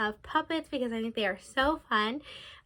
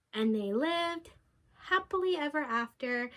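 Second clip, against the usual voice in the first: ordinary delivery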